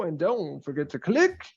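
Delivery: usual speaking voice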